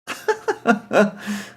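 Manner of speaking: high burst of laughter; amused chuckling fit; real laugh. Real, not acted. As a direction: laugh helplessly at the joke. Narrator laughing at the story.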